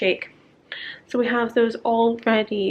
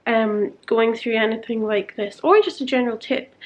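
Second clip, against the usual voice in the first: ordinary talk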